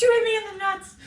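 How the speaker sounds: high, sulky mock voice; hurt boyfriend. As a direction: accuse her in a high whine. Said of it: "He goes".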